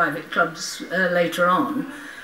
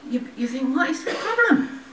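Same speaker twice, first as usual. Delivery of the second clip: laughing disbelief; 5 semitones higher than usual. Real, not acted. Laughing at press abuse.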